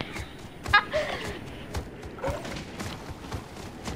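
high breathless wheeze of laughter; helpless silent-ish cackle; real wheeze laugh; wheezing laugh. Real, not acted. Cracking up at Dino Dare.

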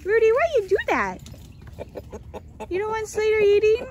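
playful scolding, pitched high; mock scolding pet voice. Real, not acted. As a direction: tell the rooster off in a sing-song.

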